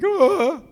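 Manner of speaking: sudden high loud cry of joy; wail of joy. Retold or imitated. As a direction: let out a joyful wailing shout. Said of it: "he wept loudly".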